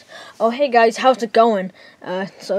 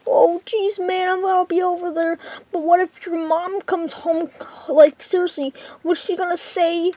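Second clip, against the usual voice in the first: nervous friend voice; 9 semitones higher than usual. Acted. Friend worries on the phone about mom, high nervous voice. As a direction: fret about the mom coming home in a high voice.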